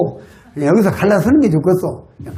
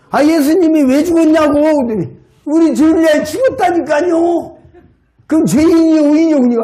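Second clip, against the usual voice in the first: raised pitch, insistent repeated question; exasperated pressing; 9 semitones higher than usual. Retold or imitated. Preacher pressing the old man again, exasperated and insistent. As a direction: push him hard for the answer, half laughing.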